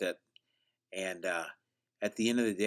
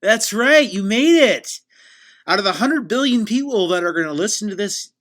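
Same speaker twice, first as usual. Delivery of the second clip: loud, high-pitched show announcer; over-the-top host opening, pitched up and loud; hyped podcast intro; 9 semitones higher, 11 dB louder than usual. Acted. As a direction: boom out a grand welcome to the show. Opens show as hammed-up host announcer.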